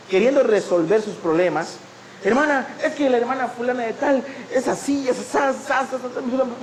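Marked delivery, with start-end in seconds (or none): 2.2-6.6 high, fast gossipy chatter; gossiping sister. Retold or imitated. Church sister complaining to the pastor about another member, in high gossipy chatter.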